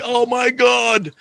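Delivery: high, slow, stunned oh my God; mock horror, drawn out; shocked gasp. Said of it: "And I'm like".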